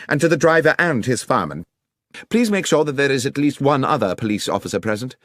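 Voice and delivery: a lightly voiced, assured Holmes; Holmes giving orders. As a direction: instruct the inspector like a confident Holmes.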